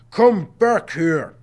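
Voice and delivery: commanding guardian calling him back; stern order. A narrator's reading. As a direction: order the boy back, each word stretched. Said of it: "Mister Hush ordered".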